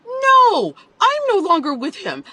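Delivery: high, loud, emphatic quoted denial; voicing other woman. Quoting the other woman's message, high and emphatic. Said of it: "Another woman writes to me".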